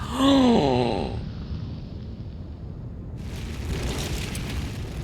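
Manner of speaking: long breathy gasp of shock. Acted. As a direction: gasp in horror. Shock after the scene.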